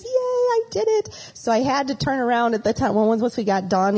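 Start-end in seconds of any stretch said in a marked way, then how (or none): 0.0-1.3 put-on high, slow little-girl cheer; a toddler proud she made it; child cheering. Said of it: "she cheers".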